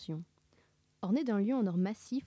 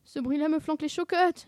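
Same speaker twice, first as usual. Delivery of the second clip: high, louder whining; fearful moan; 8 semitones higher, 5 dB louder than usual. A narrator's reading. Sammy locked in the chest, moaning in fear. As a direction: whine it in comic dread. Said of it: "gémit Sammy".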